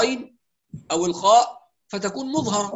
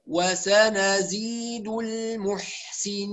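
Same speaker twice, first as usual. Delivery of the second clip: slow measured recitation of "وسنزيد المحسنين"; full vowels, unrushed; much slower than usual. Shown as a demonstration. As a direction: stretch the last "ي" of "المحسنين", every vowel complete. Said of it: "لا نختلس". (ترتيل "وسنزيد المحسنين" بتمهّل وإتمام الحركات)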